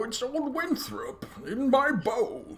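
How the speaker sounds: very high, hammed-up character voice; old Winthrop. Game master jokingly voices an old archer, high hammed-up voice. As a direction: parody a heroic pledge in a squeaky voice.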